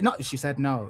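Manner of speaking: ordinary delivery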